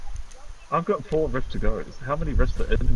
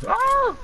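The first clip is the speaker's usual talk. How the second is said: very high-pitched little scream; alarmed squeal; 4 dB louder than usual. Real, not acted. Player seeing the storm coming, alarmed squeal.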